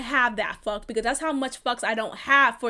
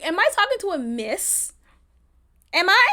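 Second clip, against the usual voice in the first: normal talking voice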